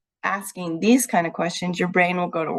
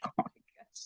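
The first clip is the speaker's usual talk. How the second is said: breathy mock-shocked whisper; whispered gasp; 16 dB quieter than usual. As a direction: gasp it out under your breath. Teasing sister who tracks her.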